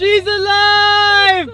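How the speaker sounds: drawn-out, high, loud triumphant shout; mad scientist cry. Pretend. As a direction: cry it out like bringing a monster to life. Celebrating his repaired car.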